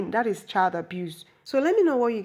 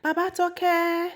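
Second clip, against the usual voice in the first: high, drawn-out call; imitated singsong summons; 10 semitones higher, 3 dB louder, much slower than usual. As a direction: call for your husband, long and high. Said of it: "reached out for the husband".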